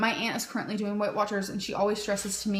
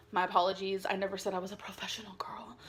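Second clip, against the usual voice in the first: a breathy whisper with no voice; whispered aside; 4 semitones lower, 8 dB quieter than usual. Real, not acted. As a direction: whisper it as a sheepish confession. Embarrassed aside to viewers.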